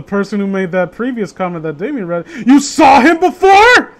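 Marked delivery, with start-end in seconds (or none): 2.4-3.9 high, slow, loud incredulous mockery of the commenter; mock disbelief. Streamer mocks a commenter's question with loud slow disbelief. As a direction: stretch out the question as if it were absurd.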